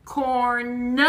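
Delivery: "Corn" held, then an extra nuh for the n; final sound separated. Shown as a demonstration. Teacher showing the end sound, "Corn" stretched into corn-nuh. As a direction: release the n of "Corn" as its own beat. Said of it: "The na is at the end".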